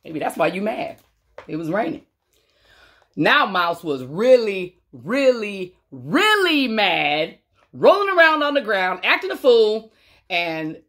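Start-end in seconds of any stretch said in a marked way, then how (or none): 3.1-7.4 drawn out slowly, leaning on really; slow emphasis. A narrator's reading. Reading to kids, building up how mad Mouse is. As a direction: stretch each really. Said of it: "slowing for emphasis".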